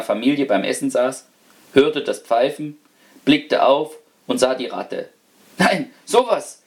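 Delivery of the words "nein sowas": higher startled exclamation; surprised cry. Acted. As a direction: jump up in shock. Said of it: "rief er".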